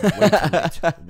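loud, slow, high proclamation; mock announcement. Real, not acted. Hearing the timer go off, loud, slow and high. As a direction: declare it dramatically.

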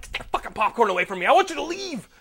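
fast, rising to a high, loud yell; angry yell at Mario over popcorn; angry outburst. Retold or imitated. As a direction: shove the offer away, furious. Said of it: "that was pretty angry".

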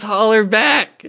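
high, drawn-out mock call; playful high-pitched holler; imitated holler. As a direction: imitate someone hollering, stretched out. Repeats the character's call with amusement.

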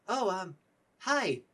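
high, hesitant character voice; nervous stammer. Acted. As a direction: stammer through an awkward introduction. Dylan nervously meets an imposing dragonborn.

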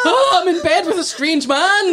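high, laughing panicked woman; startled woman voice. Retold or imitated. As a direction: play the shocked wife, giggling. Friend voices startled wife.

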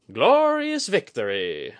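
high, loud, slow grand proclamation; said grandly. A narrator's reading. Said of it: "Kudaka said grandly".

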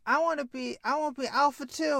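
put-on high kid voice begging to join; little brother. Little brother Bimmy to Jimmy, eager high kid voice. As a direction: pipe up eagerly like a small boy.

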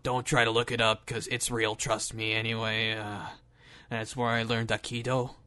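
very low, soft gruff action star; quiet mock tough-guy rumble; Steven Seagal. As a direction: mumble it low and gruff, deadpan. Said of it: "in a really low, gruff voice".

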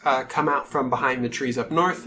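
usual speaking voice

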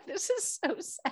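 high, soft little wail; saddened whimper. Real, not acted. Teller reacts to Esau begging his father, saddened whimper. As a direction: squeak it out softly in sympathy. Teller moved by Esau's plea.

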